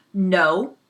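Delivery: sharp loud refusal; loud defiant shout; fierce no. Acted. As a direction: bark it as a threat. Indian refuses, knife raised.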